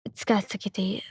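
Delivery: whispered insistence on Katsuki; unvoiced whisper, firm on Katsuki. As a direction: breathe it out, no voice. Insisting on his name.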